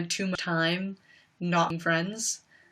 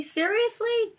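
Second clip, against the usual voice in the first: a much higher, squeaky repeat of "Seriously?"; mock exasperation; slower than usual. Reacting to bullies again, sharper second "Seriously?" squeaking up. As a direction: push the second "Seriously?" way up in disbelief. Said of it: "and it was just like".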